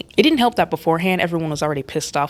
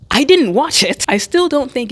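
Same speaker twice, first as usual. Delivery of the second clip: emphatic exclaim; 9 semitones higher, 6 dB louder than usual. Real, not acted. Admits not seeing the film.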